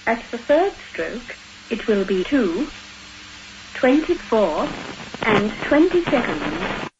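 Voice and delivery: recorded speaking clock; choppy machine-assembled time call; spliced together words. Acted. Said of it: "speaking clock you heard before".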